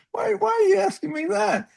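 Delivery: high, incredulous squeak; baffled. Retold or imitated. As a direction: ask in stunned disbelief. Retold baffled reply.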